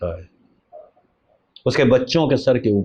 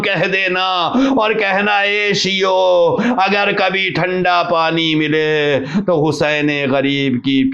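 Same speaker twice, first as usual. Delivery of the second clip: higher and higher, half-chanted grief recital; rising mourning lament; 7 semitones higher than usual. Acted. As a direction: build the lament toward a sung wail. Mourning narration rises to wail. (بلند ہوتی ہوئی نوحہ خوانی کی آواز)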